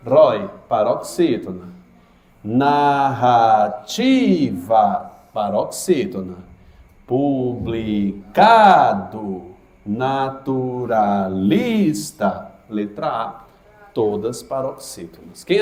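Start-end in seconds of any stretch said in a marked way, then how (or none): 2.4-5.1 "Economicamente" drawn out very slowly, syllables spaced. Shown as a demonstration. Teacher slowing down to show the stressed syllable. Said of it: "a que tem a mesma sílaba tônica". 7.0-12.5 "Economicamente" drawn out very slowly, syllables spaced. Shown as a demonstration. Teacher slowing down to show the stressed syllable. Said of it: "a que tem a mesma sílaba tônica".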